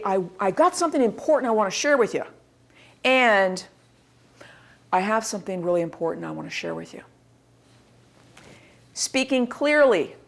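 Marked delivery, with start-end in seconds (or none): 0.0-2.2 eager, quick delivery; natural enthusiasm. Shown as a demonstration. Presenter with something important to share, naturally enthusiastic. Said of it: "the enthusiasm of naturally". 4.9-7.0 low, soft, hurried and lifeless; unenthusiastic. Shown as a demonstration. Presenter sharing important news, without enthusiasm. Contrast take to natural enthusiasm.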